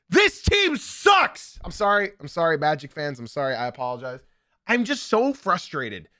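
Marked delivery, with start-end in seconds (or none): 0.1-1.6 high, slow jeering drawl; sneering high taunt; mocking jeer. Real, not acted. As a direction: stretch it out to mock the team. Trashing Magic, big pitch jump.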